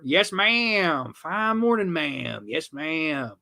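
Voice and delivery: mock Southern accent, stretched words. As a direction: imitate a polite Southern gardener. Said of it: "Southern accent".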